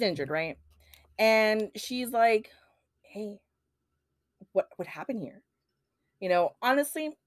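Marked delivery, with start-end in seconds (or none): 3.0-5.4 very soft, near-whispered acting; hushed retold question. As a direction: voice her hesitant discovery under your breath. Wife finds injured husband, retold.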